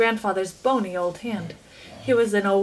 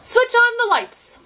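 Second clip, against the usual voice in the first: much higher, louder, slow command; shouting; 13 semitones higher, 5 dB louder than usual. A narrator's reading. Chocolate maker ordering the lights on, shouting. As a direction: bellow it down the tunnel. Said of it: "shouted Mister Wonka".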